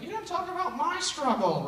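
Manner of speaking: high, mock-indignant student voice; mocking student complaint. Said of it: "So if you're like".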